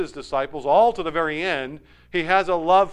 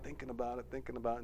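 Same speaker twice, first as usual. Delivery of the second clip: hushed brooding; 8 semitones lower, 17 dB quieter, much faster than usual. A narrator's reading. Preacher picturing Judas brooding at the table, hushed. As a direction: drop much softer and lower, musing.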